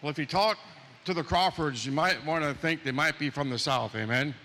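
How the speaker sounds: ordinary delivery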